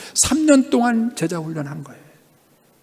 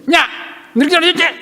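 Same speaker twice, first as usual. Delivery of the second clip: mock scolding; 10 semitones higher, faster than usual. Daughter scolding her doll with a switch, mock scolding. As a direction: imitate a child telling off a doll. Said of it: "휘초리를 들고 혼내는 거예요".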